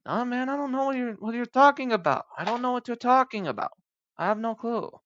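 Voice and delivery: high-pitched voice of a stumped worker; clueless employee. Acted. Employee answering the coffee shop owner, clueless.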